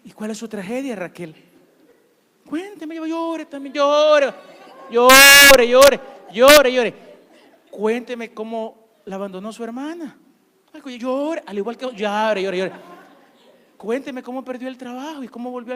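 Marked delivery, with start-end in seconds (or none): 2.5-6.9 loud, high chanting "llore"; mock shout. Preacher mock-urging Raquel to cry, loud chanting shout. 10.7-12.7 mock urging. Urging congregation to cry.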